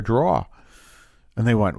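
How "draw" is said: drawer as a Boston "draw", final r dropped; lightly Boston-accented drawer. Retold or imitated. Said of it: "you once spoke with a Boston accent".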